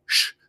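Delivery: a bare hushing "sh"; sh sound. Shown as a demonstration. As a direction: sound out sh alone, as a consonant. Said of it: "they say it like a".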